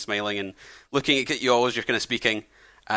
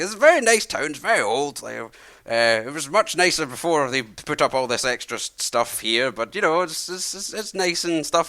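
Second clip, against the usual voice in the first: put-on higher, kindly old man voice; friendly old man; 4 semitones higher than usual. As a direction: play a chatty old host welcoming travellers. Old man chats while pottering.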